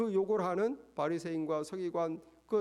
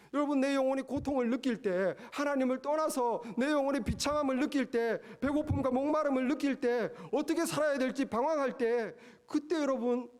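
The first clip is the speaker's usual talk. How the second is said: building sermon cadence, pitch climbing; impassioned preacher's build; rising preaching; 4 semitones higher than usual. Acted.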